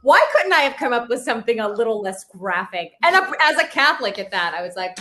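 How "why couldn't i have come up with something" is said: high, loud embarrassed laugh through the words. Real, not acted. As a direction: laugh at your own blunder. Laughing at her own quote.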